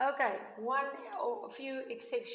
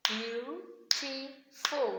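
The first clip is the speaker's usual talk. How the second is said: "Beautiful" very slowly in three separate beats; syllable by syllable; 3 semitones higher, much slower than usual. Shown as a demonstration. Teacher clapping syllables with the class, "Beautiful" broken syllable by syllable. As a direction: clap out "Beautiful", a gap after each syllable. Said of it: "can you clap with me".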